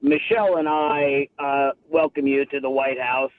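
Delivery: low, halting presidential voice; President Obama. Acted. Caller breaking in on his date as the president, amateur impression. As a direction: impersonate a president's measured welcome. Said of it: "it's President Obama on the phone".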